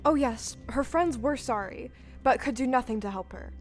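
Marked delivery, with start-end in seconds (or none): none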